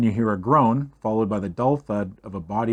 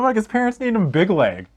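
normal talking voice

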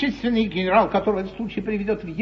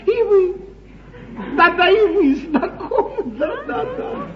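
laughing; 10 semitones higher, slower than usual. Acted. Teasing the duchess with a secret.

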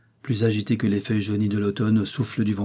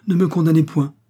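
higher, louder protest; impassioned appeal, voice raised; interrupting plea; 6 semitones higher, 6 dB louder than usual. A narrator's reading. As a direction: cut in urgently to plead. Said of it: "interrompit le jeune homme".

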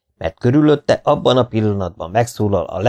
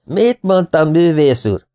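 high, crowing acted shout; boastful old man's cry; triumphant shouting; 8 semitones higher, 4 dB louder, slower than usual. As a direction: call out jubilantly to the artist. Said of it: "kiabált az ősz".

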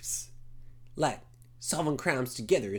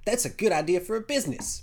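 ordinary delivery

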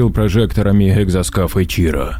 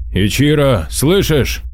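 raised, higher call; 7 semitones higher, slower than usual. Acted. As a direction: call out hoping for answer. Вызывает по связи. (громко окликая)

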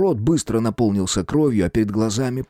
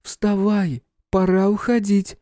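put-on girl's voice; 10 semitones higher, slower than usual. Girl urging him to leave.

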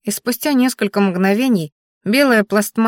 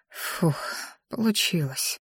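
much softer breathed relief; relieved inner exhale; 11 dB quieter, slower than usual. A narrator's reading. Narrator sighs to herself in relief, very soft. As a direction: let it out as a silent sigh. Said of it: "мысленно выдохнула я".

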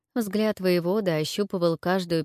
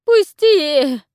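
put-on high, loud hoarse old woman's cry; rasping plea; 10 semitones higher, 9 dB louder than usual. A narrator's reading. Choked witch rasps at the warlord, hoarse and desperate. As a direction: croak it out while being choked. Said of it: "прохрипела она".

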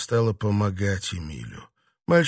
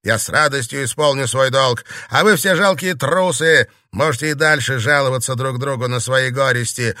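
snide tirade; 4 semitones higher, 6 dB louder than usual. A narrator's reading. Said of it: "Эмиль ехидно улыбнулся".

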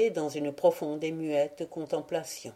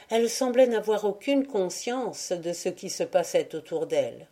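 plain reading voice

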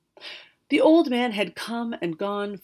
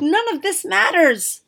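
higher, louder scornful wizard; fuming; 11 semitones higher, 10 dB louder than usual. Acted. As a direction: scoff at the flower. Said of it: "the wizard fumed".

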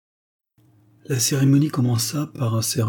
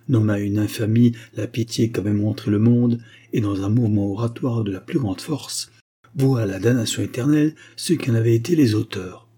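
ordinary delivery, nothing marked

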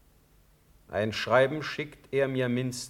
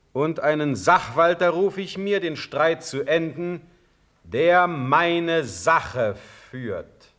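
louder and higher, building to a sharp shout of the name; angry summons; 6 semitones higher, 5 dB louder than usual. Acted. Elector angrily summons the prince, shouting his name. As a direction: call the name out like a verdict.

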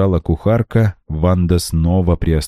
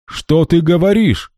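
higher, startled exclamation; alarmed cry; 11 semitones higher than usual. A narrator's reading. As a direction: cry out in shock. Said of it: "вскричал Мармузе". (встревоженный возглас)

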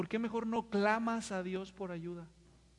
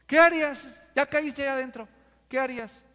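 higher, louder demand; emphatic challenge; 4 semitones higher, 8 dB louder than usual. Real, not acted. Preacher demanding what they'd do, loud. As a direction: press them for an answer. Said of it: "preacher challenging congregation".

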